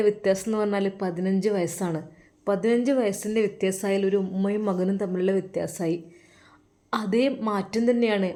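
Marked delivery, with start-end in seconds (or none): none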